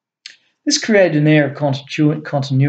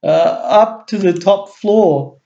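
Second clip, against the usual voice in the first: higher, slow, frightened squawk; muffled squawk; 7 semitones higher, slower than usual. A narrator's reading. Terrified guard pointing Rex to the building, muffled squawk. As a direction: cower and squawk behind your hand. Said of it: "he squawked with a muffled voice".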